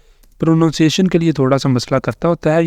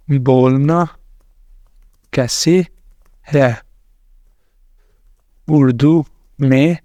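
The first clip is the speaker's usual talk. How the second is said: slow dictation; much slower than usual. Real, not acted. Dictating a prompt to ChatGPT.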